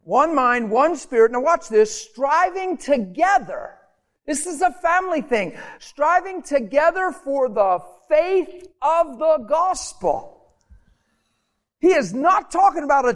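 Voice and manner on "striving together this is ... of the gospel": slow, high, stretched-out preaching of each word; drawn-out emphasis. Acted. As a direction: lean into "Striving together" slowly. Preacher drawing out key phrase.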